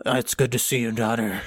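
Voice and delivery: lightly voiced game father in an accent; a hint of a stiff, badly acted dad; father with put-on accent. Father greets his returning daughter, lightly put-on accented voice. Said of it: "sounds like they had different accents".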